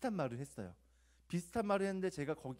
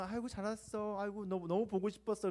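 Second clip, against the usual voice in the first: a mock gushing greeting, a bit higher; fake warm welcome; 5 semitones higher than usual. Hypocrite greeting a guest to their face, mock gushing. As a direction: overdo the friendly welcome as if it were sincere. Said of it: "겉으로는".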